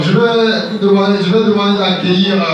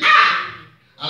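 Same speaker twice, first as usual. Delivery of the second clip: high, drawn-out comic "ah!"; exaggerated startled yelp; startled cry; 11 semitones higher than usual. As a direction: cry out high and long in mock shock. Startled reaction in the sketch.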